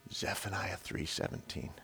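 very soft, low near-whisper; whispered voice of God. Acted. God answering the preacher's question, hushed low whisper. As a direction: breathe it gently, like a secret. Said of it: "he just whispers".

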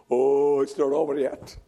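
ordinary talk, nothing marked

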